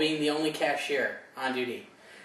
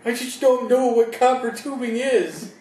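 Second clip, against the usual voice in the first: put-on high, louder whiny kid voice; mock crying kid; 7 semitones higher, 5 dB louder than usual. Mocking a teen clerk who can't find copper tubing, whiny. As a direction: play the lost teen clerk near tears.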